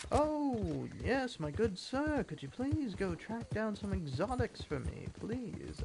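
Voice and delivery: high, put-on fussy gentleman voice; mock a polite request, high and drawn out; exotics fella. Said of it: "exotics fella was like".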